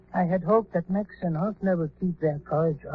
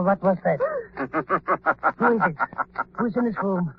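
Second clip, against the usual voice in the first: usual speaking voice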